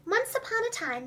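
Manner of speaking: lilting, high once-upon-a-time; storybook opening. A narrator's reading. Host beginning a story for children, softly lilting. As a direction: open the tale softly, like at bedtime.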